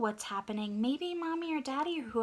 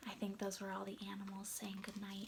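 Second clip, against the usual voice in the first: whispering; 3 semitones lower, 12 dB quieter, faster than usual. A narrator's reading. Hushed whisper after the good-night chorus.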